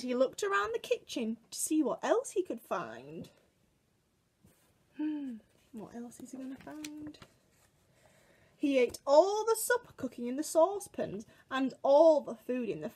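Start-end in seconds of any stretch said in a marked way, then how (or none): none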